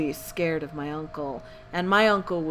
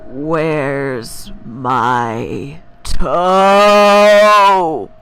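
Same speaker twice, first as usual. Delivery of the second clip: very slow, louder, lower moan; loud groaning ghost; 5 semitones lower, 11 dB louder, much slower than usual. Acted. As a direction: drag out a loud low moan, coming closer. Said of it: "it groaned".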